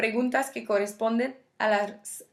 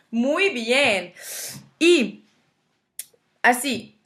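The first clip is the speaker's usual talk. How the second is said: cheerful praise; 2 semitones higher, 6 dB louder, much slower than usual. Real, not acted. Teacher praising class.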